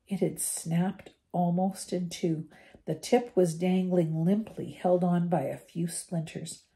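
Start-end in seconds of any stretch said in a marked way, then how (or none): none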